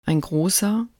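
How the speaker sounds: ordinary delivery, nothing marked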